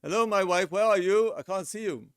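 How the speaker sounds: high, loud call to a wife far away. Shown as a demonstration. Husband calling to his wife far away, high and loud. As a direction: call out across a distance. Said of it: "does that make it close or far".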